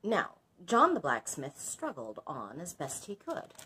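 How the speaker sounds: storyteller voice; story narration. A narrator's reading. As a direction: read it like a fairy tale to a class. Reads story aloud.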